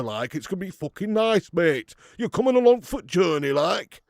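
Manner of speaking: loud, high broad Yorkshire voice; hammed-up Yorkshire bloke down the pub; Yorkshire accent. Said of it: "Yorkshire impression".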